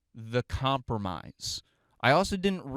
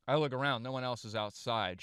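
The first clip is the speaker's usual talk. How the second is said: ordinary talk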